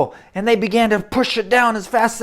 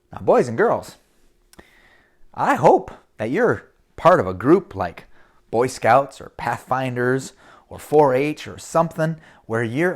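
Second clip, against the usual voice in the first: normal talking voice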